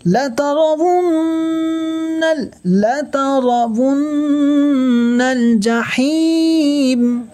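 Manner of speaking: slow, high melodic tajweed chant; slow sung Quran recitation; Quranic recitation. Shown as a demonstration. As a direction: draw out each word in recitation. Teacher chants Quran verse.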